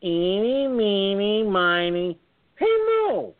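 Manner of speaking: high, loud singsong counting rhyme; loud singsong eeny meeny. Retold or imitated. Voter chanting loudly in the booth, singsong. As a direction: chant it out loud for the whole room to hear. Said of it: "I said it loud enough to be heard".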